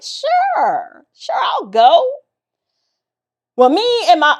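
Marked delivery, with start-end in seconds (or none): 0.0-2.1 high, squeaky eager agreement; mock eager. Agreeing to drive without a license, hammed-up eager. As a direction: chirp it out, faking confidence. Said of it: "I was like".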